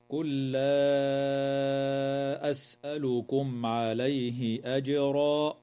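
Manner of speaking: very slow chanted tajweed; Quranic recitation. Shown as a demonstration. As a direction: stretch every long vowel as in recitation. Verse recited slowly, chanted.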